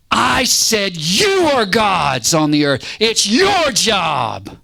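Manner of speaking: emphatic, drawn-out declaration as God; God answering. Acted. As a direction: declare it slowly, leaning hard on key words. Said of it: "God stands there and says".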